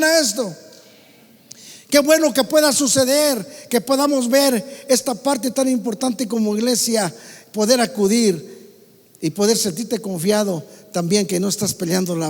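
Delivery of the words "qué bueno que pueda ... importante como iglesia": usual speaking voice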